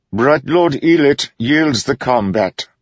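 slow, high formal pronouncement; reluctant judge. A narrator's reading. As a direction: announce it grudgingly. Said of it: "sounding reluctant".

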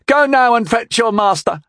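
high, loud, haughty command; angry order. A narrator's reading. Young knight orders the stranger to fetch master, angrily. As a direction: order him off in an outraged pitch.

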